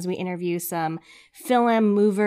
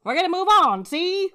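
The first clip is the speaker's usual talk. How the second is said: mock old Hollywood accent pitched higher; laughing old movie accent; playful transatlantic; 9 semitones higher, 4 dB louder than usual. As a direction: ham up the see like an old gangster film. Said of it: "mock accent, higher pitch".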